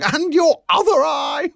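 high, loud hilarious shout; full-voiced, piercing yell. A narrator's reading. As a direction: shriek it back at your friend.

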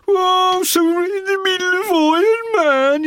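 hammed-up Bill, high and loud; ranting drummer. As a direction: wail it like a paranoid drummer. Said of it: "I'm in there on my own".

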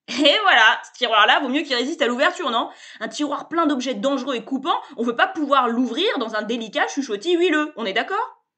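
loud, high, cheerful bluster; brash salesman. A narrator's reading. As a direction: barge in like an overfamiliar hustler. Sébastien's pitch.

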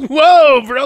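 loud, high startled cry; startled whoa. Retold or imitated. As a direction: react in shock to the drugs. Surprised at oxy.

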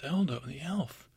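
slow, awestruck Eldo the elf; savoring the name Eldo the elf in wonder; awed repeat. Acted. Bear marvels at meeting the famous elf, slow and awed.

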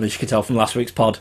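normal talking voice

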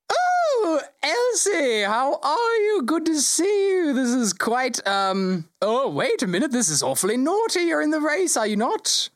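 the GM voicing the Lord Mayor, high and fussy. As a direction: pitch it way up like a flustered dignitary. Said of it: "And he goes".

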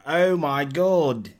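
drawn-out mock Australian accent; put-on Australian accent. Guest imitating Australian passers-by recognising him, Australian accent. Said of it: "I'm not gonna do y'all accent".

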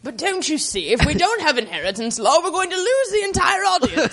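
rising to a high, mock-panicked whine; mock producer voice. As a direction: play a fretful TV producer. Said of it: "Baron Fellows seems to think".

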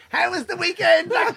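high, chirpy mock-mum voice; imitated mothers' greeting. Voices mothers arriving to collect kids.

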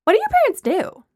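high, loud, demanding question; pushy, high-pitched grilling; interrogating. A narrator's reading. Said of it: "Lana was in full interrogation mode".

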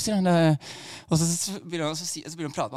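ordinary talk

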